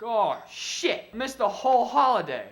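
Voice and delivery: loud, high panicked cry; alarmed realization. Retold or imitated. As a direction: blurt it out, suddenly horrified. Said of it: "I was like".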